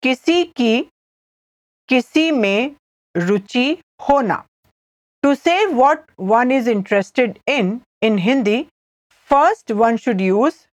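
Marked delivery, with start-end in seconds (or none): 0.0-4.4 phrase by phrase with clear pauses; careful repeat. Shown as a demonstration. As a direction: pause after each small chunk. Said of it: "I'll repeat".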